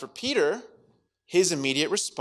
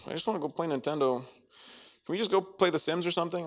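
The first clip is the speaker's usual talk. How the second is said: fast mumbled plea; 3 semitones higher, 3 dB quieter, much faster than usual. Acted. Acted awkward kid wanting to leave.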